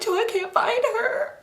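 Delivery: high, slow pretend whine; hammed-up tearful wail; sad crying. Girl telling Joe her cousin is missing, acted tearful wail. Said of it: "I'm just sad".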